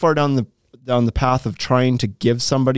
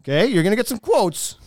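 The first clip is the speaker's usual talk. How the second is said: higher, smug cut-price pitch; slick used car salesman; 5 semitones higher than usual. Acted. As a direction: brag about the price like a hustler. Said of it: "Now you sound like a used car salesman".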